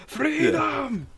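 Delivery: high, far-off war shout; battle cry. Pretend. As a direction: roar it like a rebel charge. Mock Irish battle cry.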